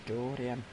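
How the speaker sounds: low, soft "Dorian" as his father says it; like Dorian's dad. Retold or imitated. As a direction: imitate a father saying "Dorian", low and quiet. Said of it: "like his dad does".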